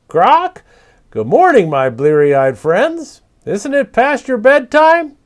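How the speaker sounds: high, loud squawking parrot voice; cheeky bird teasing his tired friends. Acted. Papagayo teasing the night creatures, high squawky parrot voice.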